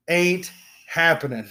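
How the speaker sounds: ordinary talk, nothing marked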